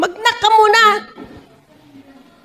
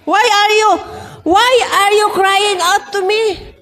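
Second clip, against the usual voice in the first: higher-pitched, drawn-out questioning; mock rebuke; 4 semitones higher, slower than usual. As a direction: act out a challenge to someone pleading. Acts God's rebuke of Moses. (kunwaring paninita)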